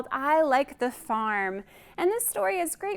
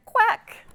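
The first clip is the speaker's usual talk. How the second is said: quack; 9 semitones higher, 5 dB louder than usual. A narrator's reading. Horse quacks in mixed-up story.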